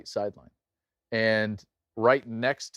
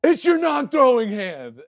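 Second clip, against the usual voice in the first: loud, high coach's holler; imitated sideline shout; yelling coach; 13 semitones higher, 9 dB louder than usual. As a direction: yell it at a hurt player, brushing off the injury. Coach yelling at injured player, retold.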